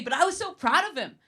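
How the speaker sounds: usual speaking voice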